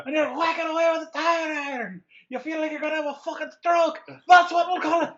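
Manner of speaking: high, shouted mock-Scottish comedy rant; Robin Williams' Scottish rant. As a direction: holler it in an over-the-top Scottish accent, way up high. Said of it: "reminds me of the Robin Williams sketch".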